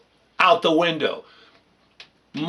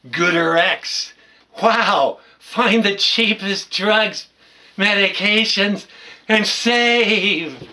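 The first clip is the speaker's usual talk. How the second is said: higher, louder pitchman voice, slowed on the slogan; mock TV ad; 5 semitones higher, 5 dB louder, much slower than usual. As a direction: ham up a drug commercial announcer. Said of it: "the latest on the television shows ... where the advertisements are".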